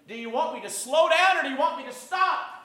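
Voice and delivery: high-pitched, pressing demand; insistent policeman. Acted. Policeman questioning the man he is beating, insistent demand.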